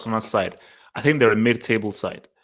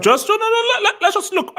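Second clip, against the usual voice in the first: excited protest. Real, not acted. Host cutting off a co-host mid-argument, high laughing protest. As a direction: wave it off, laughing, much higher.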